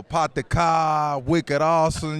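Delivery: put-on loud, slow Boston accent; hammed-up New England accent, drawn out. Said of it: "we kick it real well with the New England accent".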